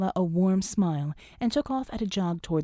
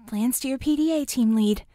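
higher, louder chirpy device voice; a bright little gadget voice; chirped device voice; 5 semitones higher, 4 dB louder than usual. A narrator's reading. PDA to team lead, higher louder chirp. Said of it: "Pride's P D A chirped".

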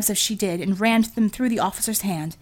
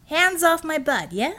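higher, slow, defiant warning with a rising end; cocky warning; 8 semitones higher than usual. Acted. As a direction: warn him off, swaggering. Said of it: "he shouted".

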